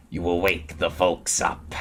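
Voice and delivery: very slow, annoyed wolf; lightly voiced wolf. A narrator's reading. Wolf scolding noisy Tom Thumb, very slow. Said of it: "said the wolf".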